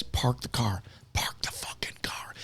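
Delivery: urgent whispered aside, no voice at all. Shown as a demonstration. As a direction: hiss it low so only she hears. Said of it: "You have to talk to these people like they're people".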